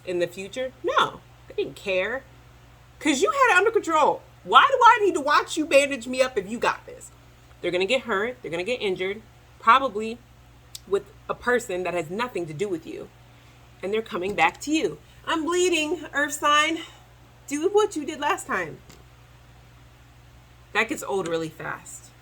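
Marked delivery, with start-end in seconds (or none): 3.0-7.1 high, loud careless mock voice; mock the client who won't learn; dismissive client. Voicing the client who just lets the healer do everything, high and loud. 15.3-18.8 high mock plea; whiny client. Hurt client running back to the healer, high mock whine. Client returns demanding help.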